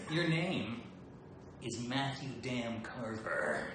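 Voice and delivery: slow, drawn-out Snake rasp. Shown as a demonstration. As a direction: say the name like Snake. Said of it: "in the Snake voice".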